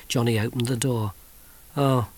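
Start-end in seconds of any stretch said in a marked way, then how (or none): none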